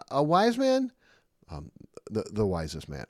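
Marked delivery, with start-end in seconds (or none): 0.1-0.9 mock surprise.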